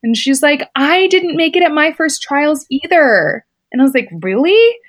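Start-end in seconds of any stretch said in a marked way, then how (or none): 0.0-3.4 higher, lightly voiced mentor comforting; a hint of a kind older swimmer consoling; gently reassuring, voice lifted; Summer Sanders. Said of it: "she put her arm around me and she's like". 4.2-4.8 high, louder disbelieving question; surprised little gasp of a question; sudden high astonished rise; amazed. Retold or imitated. Amazed at her hero's story.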